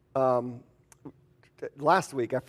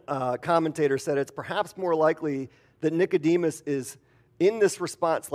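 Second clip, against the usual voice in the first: ordinary talk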